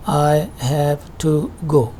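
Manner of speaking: "I have to go" word by word; slow "I have to go", each word in full; slow, careful pronunciation. Shown as a demonstration. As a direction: keep a voiced v in "have", separate from "to". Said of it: "जब आप इसको आहिस्ता से बोलते हैं".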